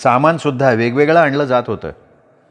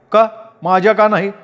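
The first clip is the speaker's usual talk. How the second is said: lightly voiced younger brother, raised pitch; brother's demand; 8 semitones higher than usual. A narrator's reading. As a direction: demand it, half-acted. (रागात विचारणारा भाऊ, हलका आवाज)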